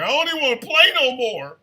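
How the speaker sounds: high, loud whining; mock whining groan with a laugh; whiny complaint. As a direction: whine like you're too sore to play. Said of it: "I was like".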